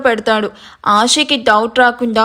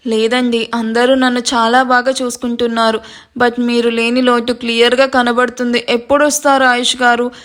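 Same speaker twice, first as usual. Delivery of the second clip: ordinary delivery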